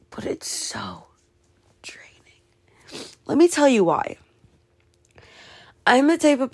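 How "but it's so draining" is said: breathy drained whisper. Real, not acted. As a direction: whisper it, worn out.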